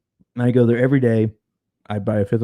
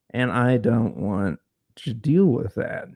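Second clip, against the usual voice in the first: ordinary talk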